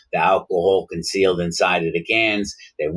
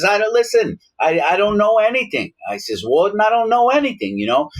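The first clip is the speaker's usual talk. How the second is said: much higher, flustered protesting; cornered inmate pleading with the warden, higher; mock nervous pleading; 12 semitones higher than usual. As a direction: squeak out a panicky denial. Prisoner pleading innocence to warden.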